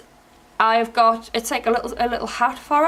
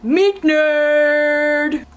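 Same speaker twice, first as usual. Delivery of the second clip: character callout; 5 semitones higher, slower than usual. Shown as a demonstration. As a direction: belt the names out. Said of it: "and that is Noodle Boy and Meat Nerd".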